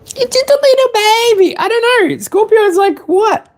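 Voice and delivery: put-on very high squeaky baby voice; tiny baby voice. Reader voicing a baby frog, very high and squeaky. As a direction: squeak it like a little critter.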